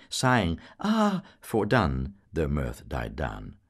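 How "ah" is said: high, loud breathless sigh; panting sigh. A narrator's reading. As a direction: gasp out of laughter.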